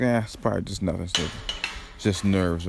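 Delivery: quiet, offhand self-reassurance; re-enacted casual brush-off; dismissive. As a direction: shrug it off under your voice. Quotes own brushing off of queasiness.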